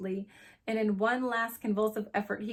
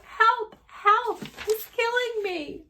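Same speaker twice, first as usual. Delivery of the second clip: very high, drawn-out cries; screaming for help; 13 semitones higher, 7 dB louder, slower than usual. A narrator's reading. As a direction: shriek like a hysterical child in mortal danger.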